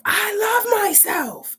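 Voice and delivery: very high, drawn-out defiant scream; full-voiced re-enacted scream. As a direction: scream it out slowly, stretching the words. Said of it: "I'm screaming".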